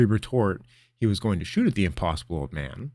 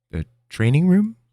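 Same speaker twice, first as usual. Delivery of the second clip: puzzled question; 11 semitones higher, 7 dB louder than usual. Acted.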